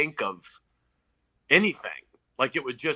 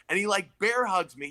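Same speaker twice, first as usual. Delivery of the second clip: normal talking voice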